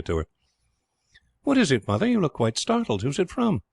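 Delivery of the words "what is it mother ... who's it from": put-on boy's voice. A narrator's reading. Boy Ralph questions his mother.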